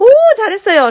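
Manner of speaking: 높고 크게 감탄하는 오; 아이를 한껏 칭찬하듯; 신나는 칭찬. Acted. Big praise for child's answer. (아이에게 감탄하며 칭찬하는 말투)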